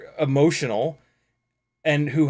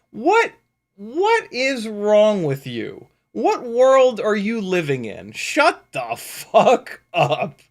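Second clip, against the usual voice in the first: higher, louder, slowed disbelief at the trolls; exasperated rant; 5 semitones higher, 3 dB louder, slower than usual. Real, not acted. Ranting at people who leave negative comments, exasperated. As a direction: hammer each word at them, slow.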